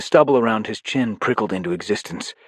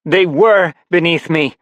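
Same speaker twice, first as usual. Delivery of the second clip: much higher, louder and slow; haughty defiance; 9 semitones higher, 8 dB louder than usual. Acted. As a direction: look down on him. Paige defies Life Force.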